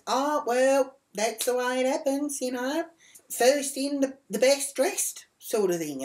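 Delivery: high, singled-out voice for the shop worker; mock high-pitched "oh well"; dismissive shop worker. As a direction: imitate her breezy brush-off. Imitated shop worker brushing her off.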